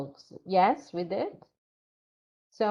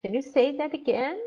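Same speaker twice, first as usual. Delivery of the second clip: AGAIN rising high at the end; questioning prompt; 7 semitones higher, 4 dB louder than usual. Shown as a demonstration. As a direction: lift the pitch to invite a retry on AGAIN. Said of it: "you give them prompt".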